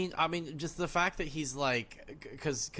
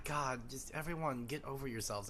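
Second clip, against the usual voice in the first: normal talking voice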